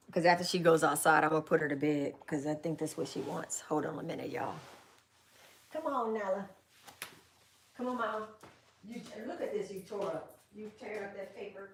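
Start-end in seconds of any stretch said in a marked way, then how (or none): 5.7-11.7 soft coaxing voice for the puppy; coaxing the dog. Real, not acted. Letting her puppy Nala outside, soft coaxing. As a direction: gently coax her out.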